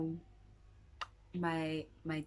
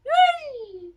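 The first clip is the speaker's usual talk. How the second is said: much higher, much louder cheer of relief; excited cheer; 16 dB louder than usual. Real, not acted. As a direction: burst out yay with relief. Cheering after dreaded news avoided.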